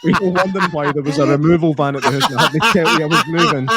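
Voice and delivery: high, laughing neighbour voice; mock neighbour reply. Neighbour explaining the removal van, playful mock voice. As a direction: answer like a clueless neighbour. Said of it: "neighbour's reply".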